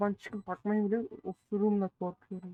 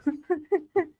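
sudden high laugh; burst of laughter; 8 semitones higher, 5 dB louder than usual. Real, not acted. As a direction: crack up out loud. Laughing at a five-kill bomb.

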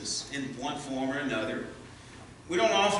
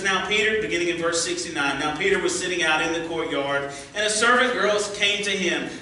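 raised, dramatic Bible reading voice; scripture reading; 3 semitones higher, 4 dB louder than usual. Acted. Preacher reading Matthew's account to the church, dramatic and raised. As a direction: read the Gospel passage aloud to the congregation.

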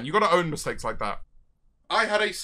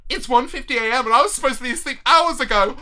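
ordinary delivery

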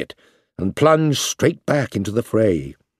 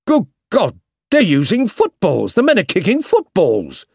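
alarmed shout; 6 semitones higher than usual. Acted. Soldier shouting down the line at the footballs, horrified. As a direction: cry out in disbelief down the line. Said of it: "was heard down the line".

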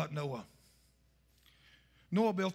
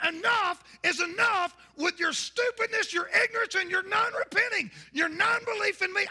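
God's angry rebuke; 7 semitones higher than usual. Retold or imitated. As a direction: thunder at them like a fed-up God. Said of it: "God's saying".

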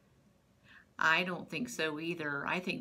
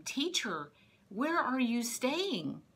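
lightly acted, higher-pitched question; curious follower; 6 semitones higher, slower than usual. Andrew and his friend asking their teacher, curious. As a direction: ask with a hint of eager curiosity, drawing it out.

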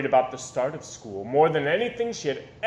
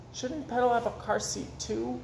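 put-on worried child; 7 semitones higher, 5 dB quieter than usual. Child worrying about doll.

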